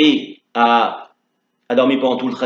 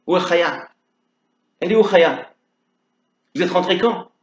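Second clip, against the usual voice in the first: worried; 6 semitones higher than usual. Acted. Le rabbin s'inquiète pour Chaya.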